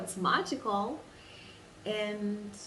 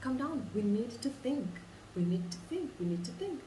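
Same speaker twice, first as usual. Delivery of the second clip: calming inner voice; 4 semitones higher, 3 dB quieter than usual. Acted. An inner voice calming someone freaking out, soothing. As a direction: gently talk someone down from panic, lightly higher and hushed. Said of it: "you hear something just telling you".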